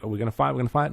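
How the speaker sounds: ordinary talk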